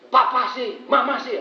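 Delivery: loud, high blaming back and forth; mock bickering couple. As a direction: toss the blame like a squabbling spouse. Couple blaming each other.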